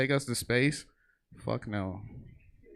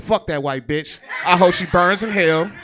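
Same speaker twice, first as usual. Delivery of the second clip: put-on louder, higher kid's rude outburst with a laugh; kid yelling, laughing; 6 semitones higher, 10 dB louder than usual. Comic retelling school kid's outburst, loud and laughing. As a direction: blurt it like a cheeky kid.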